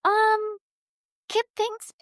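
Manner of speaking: nervously sounding out the mentor; lightly voiced hesitant message; texting mentor. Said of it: "to be like".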